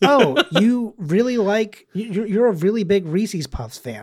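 hammed-up pleasant surprise; mock amazement. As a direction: play at being amazed by a fan. Acts out joke exchange.

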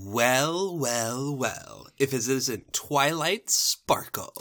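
slow, drawn-out gloating; smug villain greeting. Acted. Rival greeting Twilight Sparkle, slow and smug. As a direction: step down through it slowly, savouring it.

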